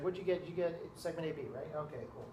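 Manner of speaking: softly checking an answer with a neighbour; hushed classmate. Retold or imitated. Student quietly comparing answers beside him.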